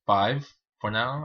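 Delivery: ordinary talk, nothing marked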